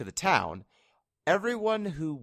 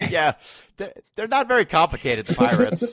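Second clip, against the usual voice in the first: laughing, pitched up and louder; 8 semitones higher, 8 dB louder than usual. Real, not acted. Host laughing about the simple game pirates, high and loud. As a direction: crack up while saying it.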